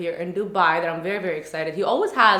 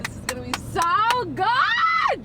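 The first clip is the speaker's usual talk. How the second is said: excited squeal; 13 semitones higher, slower than usual. Real, not acted. Excited before the speech.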